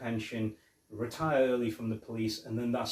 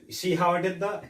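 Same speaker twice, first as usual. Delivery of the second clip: higher, louder cocky cop voice; mock boast; 6 semitones higher, 6 dB louder than usual. Riggs bragging to Murtaugh about the leg shot, higher and louder. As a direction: show off the clean shot.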